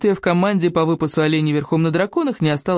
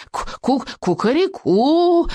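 much higher, slow rooster crow; victory crow; 9 semitones higher, slower than usual. A narrator's reading. Dragon crows his victory, much higher and slow. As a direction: crow your triumph. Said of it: "издал победный клич Беззубик".

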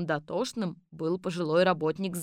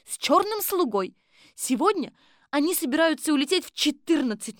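high, loud female assistant's report; eager high-voiced report to the boss; Kolbochkina reporting; 9 semitones higher, 5 dB louder than usual. A narrator's reading. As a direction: pitch it well up and report emphatically to the chief. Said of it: "Колбочкина докладывала Колобку".

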